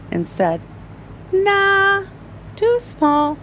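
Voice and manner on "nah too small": doctor. Acted. Doctor rejects needle.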